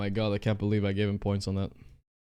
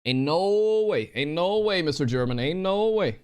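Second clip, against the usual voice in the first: disbelief; 6 semitones higher than usual. Real, not acted. Gamer protesting at his German opponent, in disbelief. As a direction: stretch it out high, refusing to believe it.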